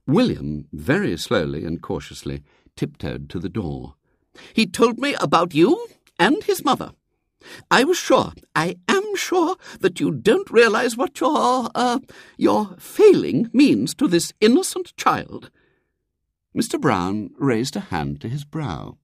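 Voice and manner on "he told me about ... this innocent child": righteous accusing lady. A narrator's reading. Visitor reproaches William's father, righteous. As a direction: hesitate delicately, then press the charge.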